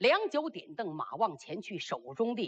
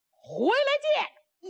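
high, stretched shout; slow rising call, held high; parting call; 14 semitones higher, 4 dB louder, slower than usual. Acted. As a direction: holler it back over your shoulder. Fighter calls out while riding off.

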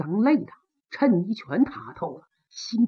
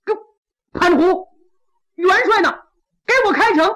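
higher, louder demand to open; shouting up; 7 semitones higher, 4 dB louder than usual. Acted. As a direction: call up to Pan Hu and order him. Qilang shouts up at Pan Hu. (向潘虎高声喝令开城)

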